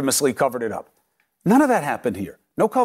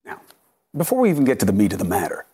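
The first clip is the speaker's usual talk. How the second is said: normal talking voice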